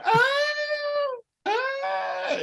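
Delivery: high yelping scream; mock scream. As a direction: let out a mock cry of fright. Imagined falling off platform.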